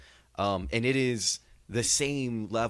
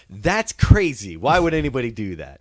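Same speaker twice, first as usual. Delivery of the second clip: loud, higher-pitched mock outburst; booming cartoon-hero impersonation, pitched up at the start; Captain Quark; 4 semitones higher, 10 dB louder than usual. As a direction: blurt it out big, hammed-up disbelief. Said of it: "And, and Captain Quark's like".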